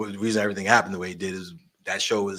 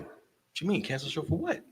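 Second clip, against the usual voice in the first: incredulous; 7 semitones higher, 6 dB quieter than usual. Retold or imitated. Retelling disbelief at cancelled show.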